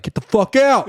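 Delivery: put-on high New York accent bartender. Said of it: "I don't do a good New York accent".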